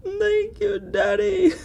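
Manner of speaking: high squeaky imitation of the puppet; mimicking the cartoon puppet's thanks; high mock Pinocchio. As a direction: quote it in a high, giggly mock voice. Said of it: "goes from saying father to daddy".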